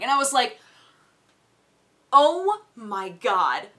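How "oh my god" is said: slow, drawn-out disbelief; very slow, fed-up delivery; exasperated. Retold or imitated. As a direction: stretch it out in mock despair. Retold exasperation at the valet.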